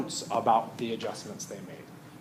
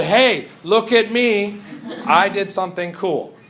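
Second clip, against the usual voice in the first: louder, higher boastful call; mock boasting; 7 semitones higher, 6 dB louder than usual. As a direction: imitate a show-off announcing a discovery, drawing out the opening. Imitating scientists boasting of their discovery.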